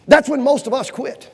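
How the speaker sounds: normal talking voice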